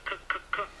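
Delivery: mock stutter on co- before commitment; playfully stuttering over the word commitment; stuck on commitment. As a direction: stammer co- co- co- then push out commitment. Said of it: "They can't say it".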